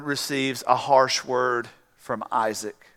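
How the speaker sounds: ordinary delivery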